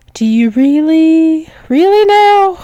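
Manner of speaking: high, rising mock doubt; teasing disbelief. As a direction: lift the pitch as you playfully question them.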